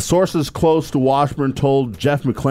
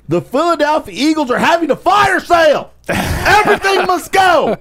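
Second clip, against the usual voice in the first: hard sell ad; 11 semitones higher than usual. Pretend. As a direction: yell it like a used car ad. Fire sale ad parody.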